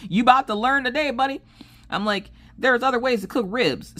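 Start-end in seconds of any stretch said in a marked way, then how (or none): 0.1-1.4 high, laughing tease; teasing challenge. Retold or imitated. Wife teasing her New York husband, high and laughing. 2.6-3.9 high, knowing retort; sassy retort. Retold or imitated. Wife telling husband ribs need no grill, high and sassy.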